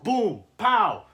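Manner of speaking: loud, high cartoon punch sounds, boom and pow; playful fight sound effects; mock fight noises. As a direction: shout the hits like a comic book.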